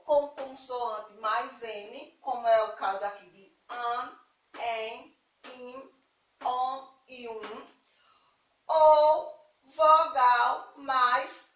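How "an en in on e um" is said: "an, en, in, on e um" hummed out through the nose; nasal vowels. Shown as a demonstration. Teacher showing pupils the nasal sound, "an, en, in, on e um" said through the nose. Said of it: "Som nasal é aquele sonzinho que".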